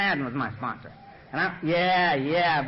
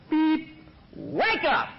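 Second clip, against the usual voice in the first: higher-pitched wake-up call over the answering machine; mock wake-up call; 9 semitones higher, much slower than usual. Sponsor on the answering machine waking him, high-pitched call. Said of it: "And it's Donald's voice".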